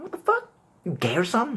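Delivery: squealing high outrage; mock shocked. Friend acting shocked at the straight guy, squealing high. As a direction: shriek it in disbelief. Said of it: "I'm like".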